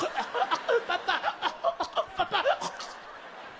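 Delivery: high, strangled gasps for Papa; choking child. Acted. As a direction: choke and gasp as you're dragged along. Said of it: "little Jean-Pierre is choking".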